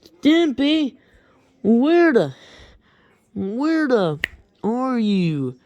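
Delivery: high, loud calling voice for Mister Horse; a kid's high put-on horse voice calling. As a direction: call out for Stimpy up high. Kid voicing Mister Horse calling.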